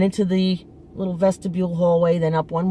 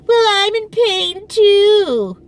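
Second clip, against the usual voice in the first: loud, slow, much higher mock mother whine; competing mother; 12 semitones higher, 6 dB louder, much slower than usual. Daughter mimicking her mother claiming pain too, loud and slow.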